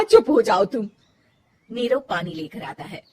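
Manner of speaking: plain reading voice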